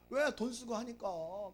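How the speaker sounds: ordinary talk, nothing marked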